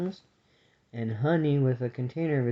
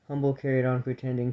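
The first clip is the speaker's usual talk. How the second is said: ordinary delivery, nothing marked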